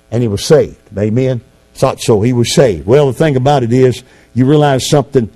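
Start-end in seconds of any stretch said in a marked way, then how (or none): none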